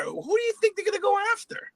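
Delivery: high, strained retelling of her own words; telling a scam victim that customs blocks cash, high and tight; mock high voice. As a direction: squeeze it up high and tense. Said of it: "I said to her".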